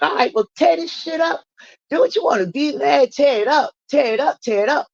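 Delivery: mimicked counselor egging someone on, high-pitched; goading. Counselor to angry woman tearing up her room, imitated goading. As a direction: imitate an insistent dare, pitched well up.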